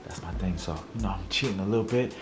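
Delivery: low, soft secretive voice; hushed. Real, not acted. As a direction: sneak it to the camera. Hiding from wife, sneaky.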